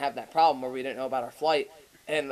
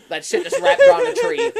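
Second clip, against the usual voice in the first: high, loud, breaking into laughter; laughing rant; 11 dB louder than usual. Real, not acted. Friend picturing the car wreck, high loud laughing. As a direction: push the words out through laughter.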